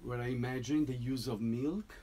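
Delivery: ordinary talk, nothing marked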